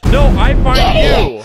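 playful loud threat; mock menace. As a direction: loom over the hider. Hide and seek, mock threat.

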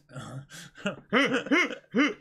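high loud burst of laughter. Real, not acted. Laughing hard at the arm getting cut off. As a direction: cracks up at the arm joke.

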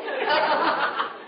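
a burst of high laughter. Real, not acted. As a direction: laugh out at the memory. Laughs at own story.

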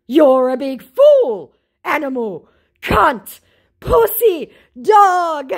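high, loud, slow ranting abuse; mock furious insults. Scammer's insult tirade.

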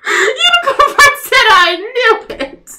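high, loud and laughing; excited squeal. Real, not acted. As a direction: squeal with glee.

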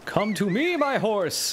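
high dramatic call to a horse. Pretend. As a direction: summon the horse grandly. Calling a horse in game.

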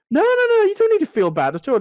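high, loud no no no, rushing to reassure; overly keen to calm him down; eager reassurance. Retold or imitated. Barista reassuring a customer, high and loud. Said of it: "I was like".

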